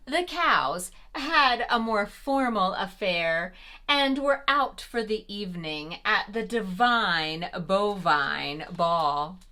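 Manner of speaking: plain reading voice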